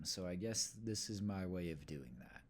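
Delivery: sheepish whisper; confessing under his breath; whispered aside. Real, not acted. Self-conscious aside.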